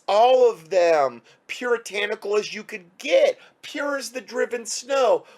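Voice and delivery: high, sugary sarcastic sing-song; mock innocent. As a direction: sneer at them as sweet little angels. Mocking enemies as innocent angels.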